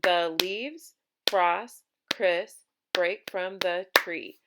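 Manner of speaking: "The leaves, frost, Chris, break from the tree" in loud, separate beats; one beat per syllable. Shown as a demonstration. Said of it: "line four has eight syllables".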